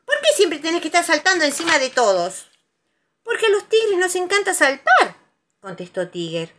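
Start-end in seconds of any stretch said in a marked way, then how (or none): 0.0-2.5 high, loud telling-off; angry shout. A narrator's reading. Conejo to Tiger over wrecked house, angry shout. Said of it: "gritó Conejo enojado". 3.2-5.1 high, loud gleeful retort; exuberant Tiger. A narrator's reading. Tiger explaining jumping to Conejo, exuberant.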